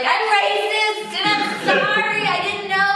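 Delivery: high, drawn-out wailing confession; mock tearful wail. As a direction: blubber an apology, hammed-up. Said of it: "I just burst out crying".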